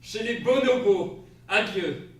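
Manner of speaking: loud, slow, deliberate "bonobos"; firm correction. Acted. Husband correcting wife's mangled word, slow and firm. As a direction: spell it out patiently.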